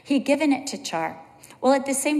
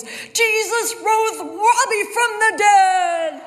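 put-on high, loud, slow triumphant kid's shout; excited little child; 10 semitones higher, 6 dB louder, slower than usual. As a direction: proclaim a miracle like an overjoyed small boy. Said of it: "Char comes running down the hallway and says".

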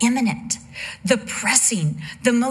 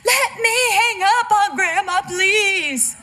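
put-on whiny little girl; 13 semitones higher than usual. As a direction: plead with daddy like a spoiled four-year-old. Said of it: "and then she's like".

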